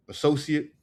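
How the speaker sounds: usual speaking voice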